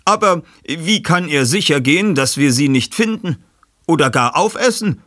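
skeptischer Gast. Acted. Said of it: "fragte ein anderer Mann".

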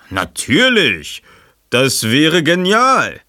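staunender Mann. Acted.

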